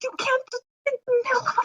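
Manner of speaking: exasperated. Acted. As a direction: snap back in disbelief. Dolma exasperated.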